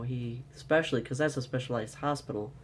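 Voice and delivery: ordinary talk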